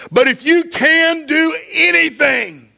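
impassioned preacher voicing a plea; desperate plea. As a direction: beg loudly as a desperate father. Father begging Jesus for his son.

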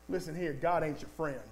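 quiet devil's persuasion; low sly Satan. Retold or imitated. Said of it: "And he said".

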